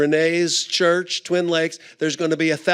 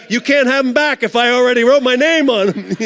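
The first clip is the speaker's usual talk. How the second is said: higher, louder, fast, half laughing; panicked thought; 7 semitones higher, 7 dB louder, faster than usual. Retold or imitated. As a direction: blurt it out in comic panic. Panicked inner thought, signing fast.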